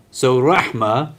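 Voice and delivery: rahma said slowly with a breathy Arabic h; Arabic word rahma. Shown as a demonstration. Teacher showing students the Arabic word, slow careful rahma. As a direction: stretch out rahma, teaching the sound. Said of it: "the root of these two".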